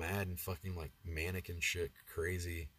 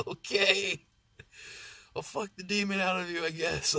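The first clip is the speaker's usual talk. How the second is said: hammed-up reply; 11 semitones higher, 5 dB louder than usual. Mock-heroic reply to possessed girlfriend.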